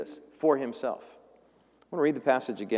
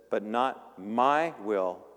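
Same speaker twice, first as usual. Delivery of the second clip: surrendered prayer; 3 semitones lower, much slower than usual. Acted. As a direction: hand over your will gently. Jesus surrendering his will.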